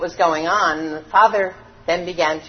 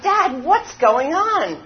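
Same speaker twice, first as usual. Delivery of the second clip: put-on puzzled boy; 8 semitones higher, 4 dB louder, slower than usual. A narrator's reading. Puzzled boy asks father.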